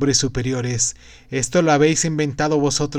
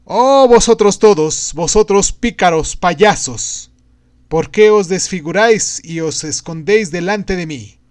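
loud, higher-pitched proclamation; loud cry; 4 semitones higher, 7 dB louder than usual. A narrator's reading. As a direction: call out forcefully to a whole crowd. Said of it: "gritó con fuerte voz".

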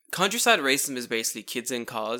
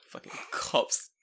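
ordinary delivery

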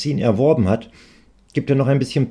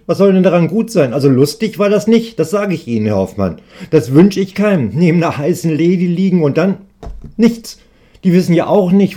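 higher and louder, getting worked up; a young man venting without a filter; worked-up rant; 5 semitones higher, 5 dB louder than usual. Acted. Student ranting to shopkeeper, worked up and louder. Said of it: "regt sich der Mann auf".

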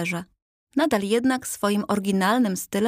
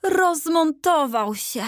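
higher, slowed dismayed cry; calling out; 8 semitones higher, 3 dB louder, slower than usual. A narrator's reading. Insurgent boy cries out over the broken piece, high and loud. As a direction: cry it out in alarm. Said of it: "zawołał powstaniec".

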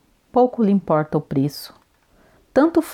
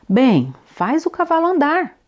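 shaky, high shout from a cramped rider; trembling shout; 9 semitones higher than usual. A narrator's reading. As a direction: shout it with a quavering voice. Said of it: "grita com voz trêmula o corcunda".

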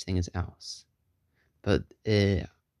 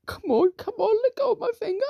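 very high, pleading squeal; hammed-up high-pitched struggle; mock panic. Character struggling with stuck finger.

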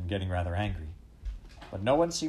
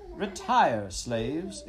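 loud, high imperious order; queen dismissing her slaves, voice ringing out; acted regal command; clear command; 5 semitones higher, 5 dB louder, much slower than usual. A narrator's reading. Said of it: "came a clear voice".

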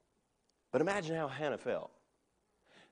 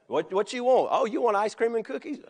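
put-on higher, sing-song voice for a little child; doting grandparent; 7 semitones higher, 6 dB louder than usual. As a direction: coax the granddaughter sweetly. Grandparent indulging granddaughter Naomi.

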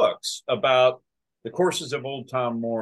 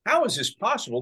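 incredulous, higher-pitched quoted question; retold amazement; 8 semitones higher, faster than usual. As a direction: ask it in mock disbelief, pitch up. Retold amazed reaction to the guest.

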